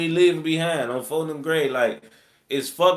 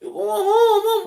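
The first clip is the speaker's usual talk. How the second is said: incredulous echo; 7 dB louder, slower than usual. Retold or imitated. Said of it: "They talking about".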